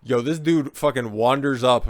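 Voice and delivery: normal talking voice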